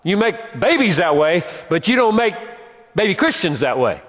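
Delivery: voicing the apostle. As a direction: speak up as the writer making his point. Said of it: "And he's saying".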